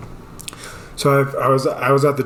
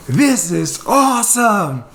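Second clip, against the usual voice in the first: high, drawn-out mock rapture; elated outburst; 7 semitones higher, slower than usual. Boy to sleepy tentmate at night, elated. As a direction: stretch it out in hammed-up wonder.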